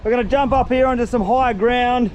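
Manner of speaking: usual speaking voice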